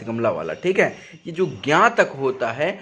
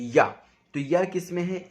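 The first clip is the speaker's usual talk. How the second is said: normal talking voice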